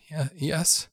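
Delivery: high, halting and tremulous; frightened stammer. A narrator's reading. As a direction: squeak it out afraid.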